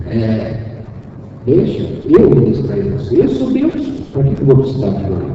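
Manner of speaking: a hint of a possessed voice, quiet and mocking; hushed spirit scoffing at the offer; spirit's voice. Spirit mocks the minister's offer to pray, quiet and scornful.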